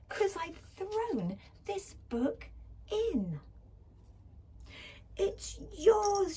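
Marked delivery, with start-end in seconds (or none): 5.2-6.4 high, loud shout of joy; Leggy shouting to the mouse, high and loud; joyful shout. A narrator's reading. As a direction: yell it out triumphantly. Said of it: "shouted Leggy".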